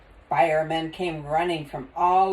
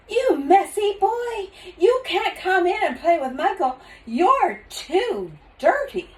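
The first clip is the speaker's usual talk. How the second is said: scolding mother; 12 semitones higher, 6 dB louder than usual. Acted. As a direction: scold the dirty boy. Mother scolds dirty son.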